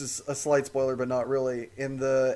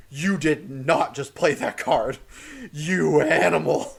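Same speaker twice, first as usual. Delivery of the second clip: mock outrage; 4 dB louder, slower than usual. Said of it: "I'm like".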